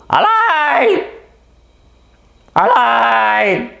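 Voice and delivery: very high, loud yelping call like a vixen; fox cry. Retold or imitated. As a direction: screech it like a fox calling. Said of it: "c'est le renard qui appelle sa femelle". (cri de renard imité, très aigu et fort)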